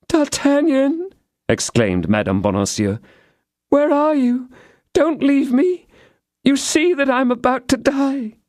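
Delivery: high, desperate plea from a dying woman; dying Constance calling. A narrator's reading. Constance, dying, begs D'Artagnan not to leave, high and desperate. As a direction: call out to him before dying.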